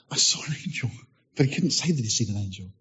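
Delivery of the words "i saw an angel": voiceless, soft mouthed words; whispered words from a priest who cannot speak; mute Zechariah. Retold or imitated. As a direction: mouth it without voice. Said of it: "He's like".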